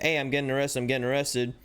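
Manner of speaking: ordinary talk, nothing marked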